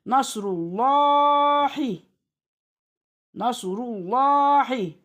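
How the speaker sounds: "Nasrullahi" chanted with a heavy, long "llaa"; thick lam recitation. Shown as a demonstration. Teacher demonstrating the rule, "Nasrullahi" with thick lam. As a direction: recite "Nasrullahi" slowly, lam full and dark. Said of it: "Na kalın".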